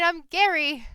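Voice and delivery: loud high goblin shout, not angry; friendly shouting. Acted. Goblin cheerfully yells his name again, not angry at all. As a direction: yell it happily, no anger. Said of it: "he wasn't angry, he was just shouting".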